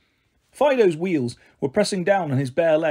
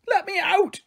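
very high fairy shriek; trapped fairy shrieking to be let out. A narrator's reading. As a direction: squeal to be let out. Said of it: "she shrieked".

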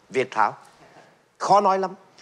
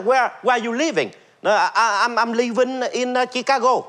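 put-on hesitant English in a higher Vietnamese accent; 8 semitones higher than usual. Man answering an American in Vietnamese-accented English. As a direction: stumble through English the Vietnamese way. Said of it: "mình nói".

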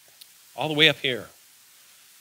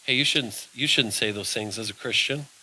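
a hint of a soft inner voice of conscience; lower, softer, a quiet nudge; gentle Holy Spirit voice; 5 semitones lower, 3 dB quieter than usual. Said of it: "the Holy Spirit ... comes along and says".

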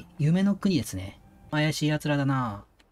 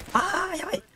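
panicked yelp. Real, not acted. Panic, pitch very high.

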